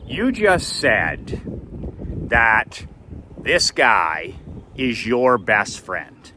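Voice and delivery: halting phrases with heavy pauses; imitating an incredulous dad, slowly and deliberately; slow, pointed confrontation. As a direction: spell out the accusation bit by bit. Dad confronts son, word by word.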